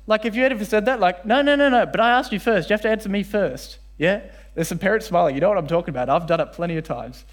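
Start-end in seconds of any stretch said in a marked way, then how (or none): 1.2-3.8 put-on high, loud insistent kid voice; mock kid insisting he asked first; whiny child protest. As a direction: whine it like a stubborn little kid. Mimicking a kid's protest.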